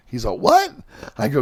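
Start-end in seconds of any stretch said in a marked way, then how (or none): 0.4-0.7 a much higher, retold yelp of surprise; shocked "what". Telling how the drummer reacted to the news, mock surprised "What?" pitched much higher. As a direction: squeak out his disbelief, imitating him.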